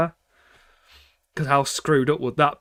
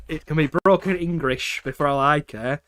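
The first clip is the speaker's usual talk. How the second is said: ordinary talk, nothing marked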